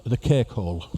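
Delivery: lightly shown Liverpool accent; Scouse accent. Shown as a demonstration. Giving passengers the best mouth word in Scouse accent. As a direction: present the slang in Scouse. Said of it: "a selection of words for your mouth".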